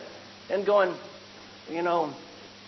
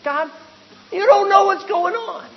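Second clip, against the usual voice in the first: higher and louder, scolding God; indignant outburst; 10 semitones higher, 10 dB louder than usual. Acted. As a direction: throw it up at heaven, indignant. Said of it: "he thinks".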